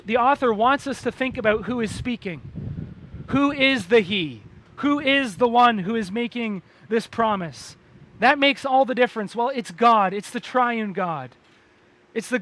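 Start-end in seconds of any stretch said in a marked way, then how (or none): none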